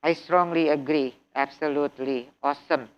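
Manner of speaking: "I strongly agree" said low, quiet and monotone; flat robot read, "strongly" with no lift at all. Shown as a demonstration. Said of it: "You cannot speak like robots".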